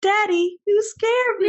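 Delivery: put-on high, scared little girl voice; squeaky frightened child voice.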